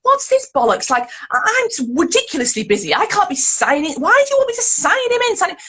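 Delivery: high-pitched, exasperated disbelief; incredulous. Retold or imitated. Busy mother faced with nursery sign-in sheet, incredulous. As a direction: re-enact an indignant, can't-believe-this rant. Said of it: "And I was incredulous".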